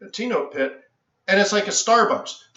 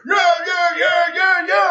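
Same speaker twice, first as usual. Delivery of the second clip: high, loud crowd whoops, imitating the traders; loud mock cheering from the floor; traders yelling; 11 semitones higher, 5 dB louder, slower than usual. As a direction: belt out the pit traders' cheer. Traders roaring on trading floor.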